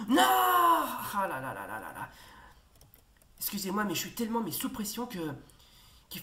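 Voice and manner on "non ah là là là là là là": despairing wail. Real, not acted. Risky play fails.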